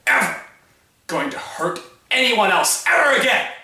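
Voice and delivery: high, very loud, emphatic shouting; impassioned angry yell; furious outburst. Acted. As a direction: shout in fierce self-justification. Defending the killing in anger.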